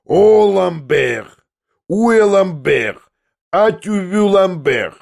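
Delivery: high, slow, drawn-out mocking howl; a long, teasing holler in French; howling at full force. A narrator's reading. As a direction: wail the name out long and loud, taunting. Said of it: "завопил изо всей силы".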